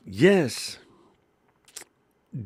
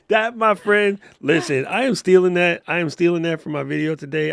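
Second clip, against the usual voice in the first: loud, higher, thrilled; excited; 5 semitones higher, 8 dB louder than usual. Real, not acted. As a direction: grab the line with glee. Excited about stealing line.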